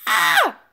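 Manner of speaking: sudden loud shout of dismay; sharp loud cry; startled scream. Real, not acted. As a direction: yelp as the game catches you. Scream at game loss.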